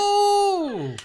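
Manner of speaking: high loud burst of delight; excited shout. Real, not acted. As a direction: shout it out in surprise. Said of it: "I didn't even mean to do that".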